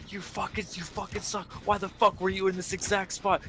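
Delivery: imitating raging teammates; mock trash talk. UK gamers trash-talking enemies, mock angry. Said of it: "they would be like".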